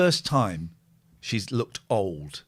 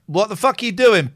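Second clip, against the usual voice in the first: outraged; 9 semitones higher, 8 dB louder than usual. Real, not acted.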